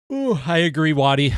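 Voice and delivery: talking through a real yawn. Real, not acted. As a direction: let a sleepy yawn run into the words. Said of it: "Sorry I yawned".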